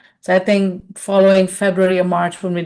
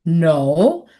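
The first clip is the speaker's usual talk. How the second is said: drawn-out rising denial, retold disbelief; incredulous; 2 semitones lower than usual. Manager telling director she never knew, incredulous. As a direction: stretch NO upward like a question.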